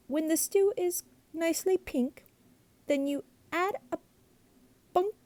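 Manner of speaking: high cheerful monster prattle; chatty glunk. A narrator's reading. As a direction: babble on sweetly to mother.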